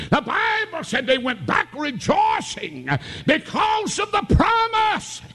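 high, shouted, drawn-out preacher's cry; preaching at full force. Acted. Preacher exalting Jesus to the congregation, full-force preaching. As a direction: lift it up and ring it out to the church.

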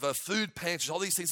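ordinary delivery

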